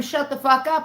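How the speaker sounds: mock scream.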